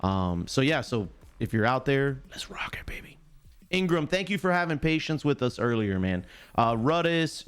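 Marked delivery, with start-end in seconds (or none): 2.3-3.2 very soft, voiceless whisper; whispered rallying call. Real, not acted. Streamer invites viewers to jump in, whispering. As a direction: drop to a whisper to urge them in. Hushed sign-off to the pitch.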